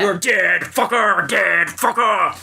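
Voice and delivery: high gleeful villain shout; villain yell. Pretend. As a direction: taunt the victim like a maniac. Acting the killer fortress.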